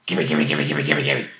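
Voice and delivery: deep, growling gimme chant from a greedy beast; greedy creature voice. Retold or imitated. The dog Blacktail begging greedily, in a deep growl. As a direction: growl out gimme like a hungry animal. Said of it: "Blacktail would be like".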